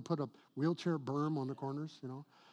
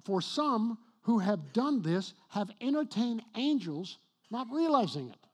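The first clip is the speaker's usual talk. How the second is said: scripture reading; 5 semitones higher, 6 dB louder than usual. Acted. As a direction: read the verse out with weight. Reads scripture.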